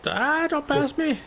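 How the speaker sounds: put-on high, slow kid voice; twelve-year-old voice. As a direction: whine it like a young boy. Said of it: "you sound more like a twelve-year-old".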